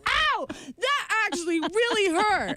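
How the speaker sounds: high, drawn-out imitated cry of pain; retold pained yell. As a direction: mimic someone yelping that it hurts. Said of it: "she's, like, yelling".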